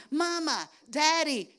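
put-on high, loud child calling. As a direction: tug for attention like a toddler. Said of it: "the little one going".

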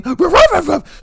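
high yipping ruff ruff; high little yap; yappy dog bark. Retold or imitated. Imitating the dog's bark.

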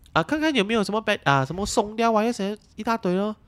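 high, quick, wriggling excuses; evasive boss. Retold or imitated. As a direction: brush it off like it's not his fault. Voicing the boss's excuses.

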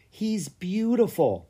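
admiring. A narrator's reading. Clara gazing at the dragon, slow tender admiration. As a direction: say it fondly. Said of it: "she said".

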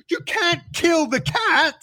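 very high, slow wail; freaked out. Retold or imitated. Son begging mum to spare the cat, freaked out. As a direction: plead in a hammed-up panicked kid voice. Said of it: "freaked out".

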